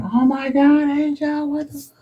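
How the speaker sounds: high mock panicked scream; imitating wife's scream. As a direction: play the wife shrieking. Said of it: "his wife is screaming".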